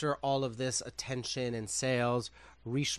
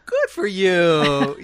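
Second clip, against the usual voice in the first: loud, high, drawn-out mock congratulations; big stretched-out phony cheer; 6 semitones higher, 14 dB louder, much slower than usual. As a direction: sing out a slow, overdone reply. Sarcastic reply to complaining staff.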